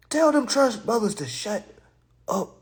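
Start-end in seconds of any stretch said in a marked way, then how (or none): none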